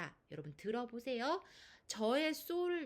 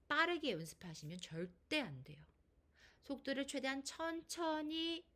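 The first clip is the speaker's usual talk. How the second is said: ordinary talk, nothing marked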